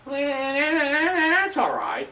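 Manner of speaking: long, high drawn-out "well"; comically stretched, high-pitched concession; reluctant concession. Acted. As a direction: hold a high note, then give in. Acts his reaction to director's refusal.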